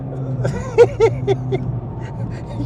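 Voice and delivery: high airy laughing gasp; breathless laugh. Real, not acted. Breathless laugh after drift.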